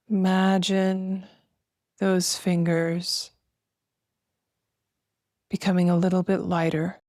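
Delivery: slow, spacious meditation voice with long pauses; guided meditation. Acted. Host guiding listeners to feel breath and weightless arms, slow meditation voice. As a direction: guide them gently through each breath, drawing words out.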